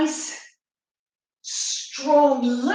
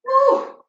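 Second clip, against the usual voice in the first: high loud cheering woo; excited woo; 12 semitones higher, 7 dB louder than usual. Real, not acted. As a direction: whoop it up high. Cheer at end of round.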